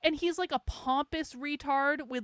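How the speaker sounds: normal talking voice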